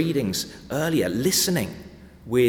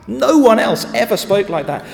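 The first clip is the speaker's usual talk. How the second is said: fervent preaching; 9 semitones higher, 8 dB louder than usual. Real, not acted. Preacher presses the point.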